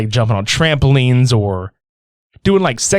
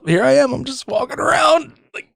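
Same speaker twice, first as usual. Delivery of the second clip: high mock-shouted goofy Bigfoot; hammed-up yelling Bigfoot after crashing; 12 semitones higher than usual. Voicing Bigfoot after careening off a cliff, high goofy shout.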